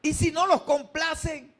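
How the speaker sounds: normal talking voice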